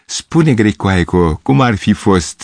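plain reading voice

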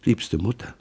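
whispering. A narrator's reading. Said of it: "flüsterte".

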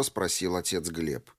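ordinary delivery, nothing marked

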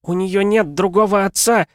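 high, loud theatrical cry; a wife dramatically crying out to her husband; pathetic exclamation. A narrator's reading. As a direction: proclaim it like a tragic heroine. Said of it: "патетически воскликнула Лариса".

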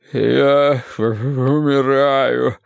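very slow, drawn-out groan; agonised, dragging words; pained moaning. A narrator's reading. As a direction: moan it as if dying. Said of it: "стонал Уве".

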